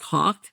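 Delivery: "hawk" with the aw partly opened; softened accent. Shown as a demonstration. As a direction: say "hawk" with only a trace of Jersey aw. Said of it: "Now I say it a little bit more like".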